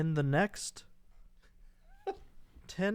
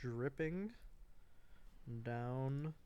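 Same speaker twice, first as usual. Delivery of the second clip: quiet murmur; 18 dB quieter, slower than usual. Real, not acted. Quiet dictation.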